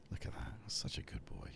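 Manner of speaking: tender whisper to a pet; whispered praise. Real, not acted. Praising the cat softly.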